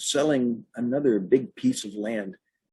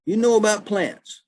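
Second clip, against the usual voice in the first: higher, louder doubting country lady; lightly voiced sceptical old woman; lady's voice; 11 semitones higher, 5 dB louder than usual. Lady questions the trespasser on plants, high doubtful voice. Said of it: "the lady says".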